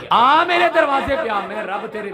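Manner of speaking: high ringing call of God; divine call. Acted. God calling the sinner to his door, loud ringing cry.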